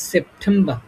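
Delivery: সেপ্টেম্বর ending in "-ba", r dropped; British pronunciation. Shown as a demonstration. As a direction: drop the final r of সেপ্টেম্বর. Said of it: "আরটা এখানে একটু উচ্চারণ হবে না". (সেপ্টেম্বর, শেষে "র" ছাড়া "বা")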